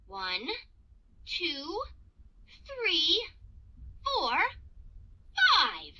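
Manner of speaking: soft, widely spaced numbers; gentle count with a long pause between numbers; slow calming count. Acted. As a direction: count slowly to cool off. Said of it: "take a deep breath and slowly count".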